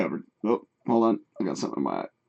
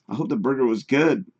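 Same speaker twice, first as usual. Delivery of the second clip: ordinary delivery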